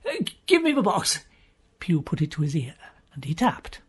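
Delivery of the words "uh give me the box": usual speaking voice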